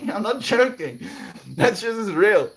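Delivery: high, loud fast giggly gushing; overwhelmed happy laughter; laughing. Real, not acted. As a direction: laugh through the words. Laughing insistence.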